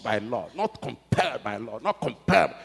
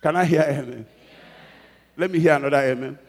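amen called out louder; 3 semitones higher, 7 dB louder than usual. Shown as a demonstration. Preacher asking the church again for amen, louder.

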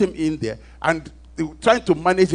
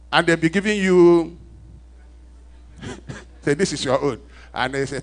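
loud, higher sermon voice, driving each point home; preaching at full force; 3 semitones higher, 5 dB louder than usual. Acted. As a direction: preach it out to the church. Preaches to the congregation.

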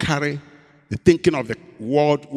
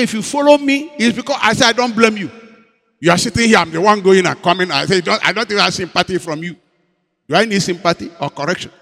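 louder, higher indignant retort; angry complaint; 3 semitones higher, 6 dB louder than usual. Retold or imitated. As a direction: argue back heatedly with wife.

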